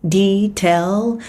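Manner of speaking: slow, each word separated; slow drill. Shown as a demonstration. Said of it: "Không đọc detail mà nó sẽ là âm A E".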